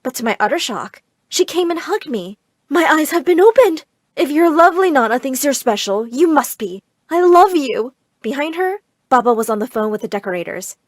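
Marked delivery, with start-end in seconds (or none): 2.7-7.9 bright, loud and high with sudden love; gushing mother. Acted. Mother hugging her neglected daughter, gushing loudly. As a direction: pour out newfound adoration.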